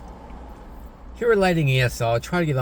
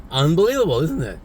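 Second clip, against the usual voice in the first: mock disbelief; 3 semitones higher than usual. Exclaiming at disbelief.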